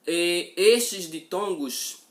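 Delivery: estes ditongos said slow and loud, estes hit hard; slow down and lean on estes ditongos; emphatic and slow. Shown as a demonstration. Teacher stressing estes ditongos so the class follows, slow and loud.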